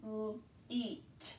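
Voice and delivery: l-eat soft with the ee held; segmenting sounds. Shown as a demonstration. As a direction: stretch the ee in l-eat. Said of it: "We hear our vowel sound here".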